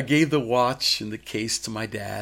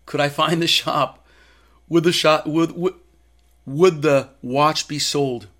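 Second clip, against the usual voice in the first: louder, stammered anxious questions; mildly acted panic, pushed louder; retold panic; 3 semitones higher, 7 dB louder than usual. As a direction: relive the panic, tripping over the words. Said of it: "I woke up in a panic".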